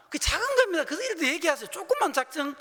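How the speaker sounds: 높고 크게 힘주어; 목소리를 확 높여 강조하며. Retold or imitated. 목사가 힘주어 가르침. (높고 크게 외치듯 강조)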